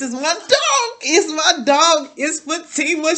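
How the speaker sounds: high, slow, squealed reveal; a hammed-up high-pitched reveal; comic high squeal. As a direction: squeak it out like a silly surprise. Joking reveal at the door.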